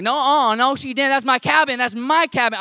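loud, high mock outrage; retold indignant alarm. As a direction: act out alarmed, protective disbelief. Alarmed reaction to screaming cabin.

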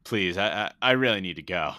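mock urgent plea, loud and stammering; M P H pleading. Tripping star begging Ron to let him go, urgent plea. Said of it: "turns around and he goes".

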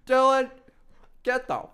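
very loud, high shout of the name, then slow, stretched-out order; hammed-up furious parent yell; retold dad summons. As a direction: bark the kid's name up the stairs. Dad summoning son angrily.

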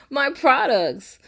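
proud slow repeat; emphatic brag. Real, not acted. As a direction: savor the brag. Repeating her punchline after laugh.

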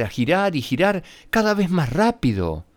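plain reading voice

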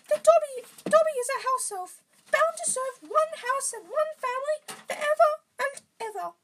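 very high, loud squeaky elf; Dobby. A narrator's reading. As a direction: answer in a squeaky falsetto. Reader acting Dobby's answer.

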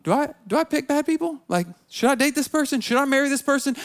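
self-questioning. Retold or imitated. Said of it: "start asking some questions like".

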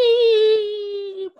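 a long, high, steady beep; imitated censor beep. Shown as a demonstration. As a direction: mimic the TV censor tone. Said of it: "but they will".

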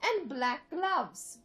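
put-on old British lady reading fanfic; Old British Lady narration. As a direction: narrate like a prim British granny.

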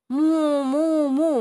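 high "mu, mu, mu" lowing like a cow; cow moo. Shown as a demonstration. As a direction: show the dog how a cow moos. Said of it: "Ascolta me".

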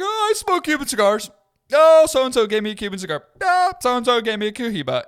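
high, loud, mocking show-off voice; mock a boastful newbie way up high; gushing falsetto brag, hammed up; bragging cigar novice. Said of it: "kind of in a conversation with Cigar Novice".

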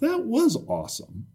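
was pitched high in emphatic agreement; stress on was. Real, not acted. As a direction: lift the word was well above the rest. Emphatic agreement echoing the other speaker.

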